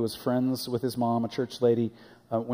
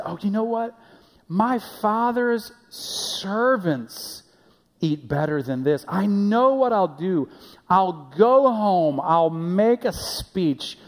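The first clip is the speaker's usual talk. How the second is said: higher, louder, slowed-down pleading son rehearsing his plan; 5 semitones higher, 5 dB louder than usual. Acted. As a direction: play the desperate younger son talking himself into going home. Prodigal son rehearses his speech.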